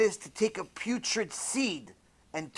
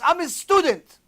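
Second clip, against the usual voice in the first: loud, high proclamation; forceful declaration; 9 semitones higher, 5 dB louder than usual. Acted. Rabbi tells the anaconda whose honor he comes in, forceful. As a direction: announce your right to pass.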